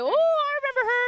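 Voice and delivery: high, loud, thrilled gasp; excited. Retold or imitated. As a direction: say it like a starstruck fan. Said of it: "I was like".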